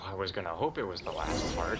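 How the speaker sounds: ordinary delivery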